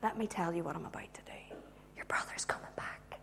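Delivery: hushed private revelation; whispering. Acted. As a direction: confide it very softly and low. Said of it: "he whispers to her".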